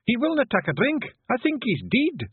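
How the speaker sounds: high-pitched alarmed Scots cry; yelling. A narrator's reading. Wife reporting her husband's refusal to the men, alarmed yell. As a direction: raise the alarm loudly. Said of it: "she yelled".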